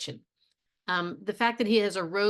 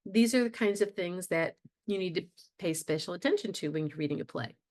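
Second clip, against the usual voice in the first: ordinary talk, nothing marked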